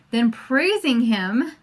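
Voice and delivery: usual speaking voice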